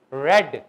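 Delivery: "ریڈ" with a short e, loud and low; pronounced like red. Shown as a demonstration. Teacher showing past form to students, "ریڈ" as "red". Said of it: "جس طرح سرخ بولا جا رہا ہے".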